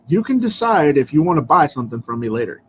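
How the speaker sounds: ordinary delivery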